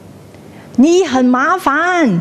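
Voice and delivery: high, drawn-out calling out; cliff-top shout. Acted. Someone on the same cliff shouting a complaint, high call. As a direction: stretch the complaint across the valley. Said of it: "you shout".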